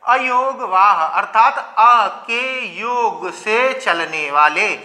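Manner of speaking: very slow, word-by-word quoting; deliberate recital. Retold or imitated. Teacher reciting other experts' definition to students, deliberate recital. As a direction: spell out their definition with stretched vowels. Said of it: "वो क्या कहते हैं?".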